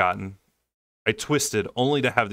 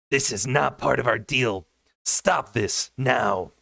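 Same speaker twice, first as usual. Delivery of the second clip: higher, slow furious demand; spat; 4 semitones higher, slower than usual. A narrator's reading. Tamlin spitting fury at the king over the broken deal. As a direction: spit each word at the king. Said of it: "Tamlin spat at the king".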